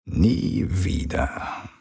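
very slow, voiceless hush; murmured. A narrator's reading. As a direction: breathe it out as a threat. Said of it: "raunte er".

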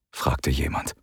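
ordinary delivery, nothing marked

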